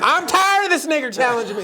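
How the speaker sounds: high, rapid outburst; mock exasperation. Panelist imagined fed up with challenger, mock exasperated. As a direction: blurt it fast in imitated anger.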